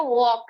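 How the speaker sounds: "वॉक" slow and even, L silent; soft walk. Shown as a demonstration. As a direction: say "वॉक" gently, without force. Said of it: "बुरी तरह से जोर से हम बोलते नहीं है". ("वॉक" धीरे और नरमी से, एल चुप)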